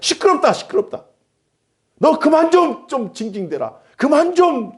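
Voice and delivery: 높은 음으로 쏘아붙이는 군중의 꾸지람. Acted. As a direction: act an annoyed crowd telling someone off, pitched high. 군중이 바디메오를 꾸짖는 장면. (높은 목소리로 짜증 내며 나무라듯)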